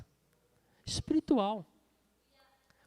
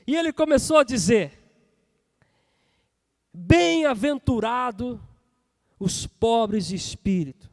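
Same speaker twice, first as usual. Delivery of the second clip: loud, raised preaching voice, falling at phrase ends; full-force scripture proclamation; 3 semitones higher, 8 dB louder than usual. Real, not acted. Preacher reads the Beatitudes to the church, proclaiming loudly. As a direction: ring out each verse opening, then let it settle.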